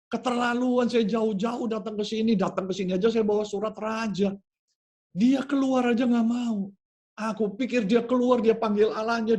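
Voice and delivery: high, indignant complaining, pitched well up; an insulted general ranting at being snubbed; offended rant. Acted. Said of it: "tersinggung dia".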